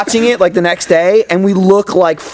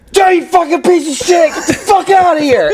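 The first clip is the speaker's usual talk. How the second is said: mock yell at cat; 13 semitones higher than usual. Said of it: "He was screaming at his fucking cat".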